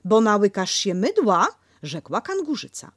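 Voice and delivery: brisk scolding; Kanga scrubbing. Acted.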